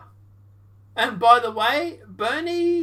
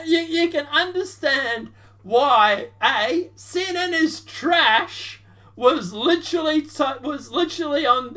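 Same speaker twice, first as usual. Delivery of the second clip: ordinary talk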